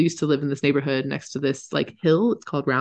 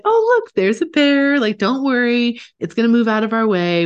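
cheery trail guide; 8 semitones higher, 4 dB louder than usual. Retold or imitated. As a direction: soothe nervous riders a bit too brightly. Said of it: "I was like".

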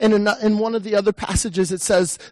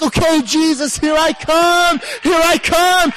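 higher and louder, slowing into a shout; eager shout; 6 semitones higher, 3 dB louder, slower than usual. Acted. Preacher voicing a follower rushing to Jesus, shouting. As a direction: call out to Jesus, running after him.

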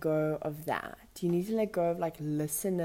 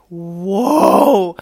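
awed whoa; 14 dB louder than usual. Retold or imitated. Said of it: "I was just like".

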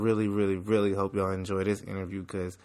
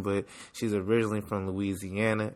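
ordinary talk

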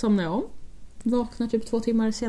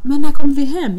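alarmed roommate; 5 semitones higher, 14 dB louder than usual. Retold or imitated.

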